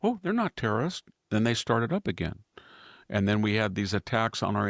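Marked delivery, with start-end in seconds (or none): 0.0-1.0 high, breezy mock brush-off; mock dismissive. Mocking Biden's view of the Houthis, high breezy dismissal. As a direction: lightly wave it away in mockery. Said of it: "first thing he did".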